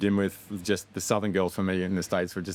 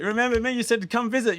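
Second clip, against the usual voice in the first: hyped-up filmmaker; 4 dB louder than usual. Retold or imitated. Reminding Billy on the phone.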